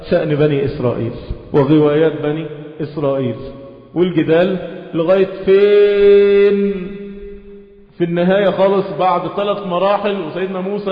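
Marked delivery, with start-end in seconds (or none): 3.9-6.9 slow, drawn-out sarcastic lilt; mocking singsong. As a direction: stretch it out mocking their endless arguing. Mocking Israelites' endless arguing. (سخرية بنغمة ممطوطة وبطيئة)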